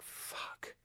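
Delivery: a horrified whisper under the breath; a speechwriter in the audience whispers in dismay as Kerry goes off script; whispered curse. Retold or imitated. Silently despairing in the audience.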